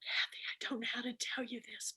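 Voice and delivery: hushed secretive whisper. Retold or imitated. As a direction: whisper it like a hostess sharing bad news.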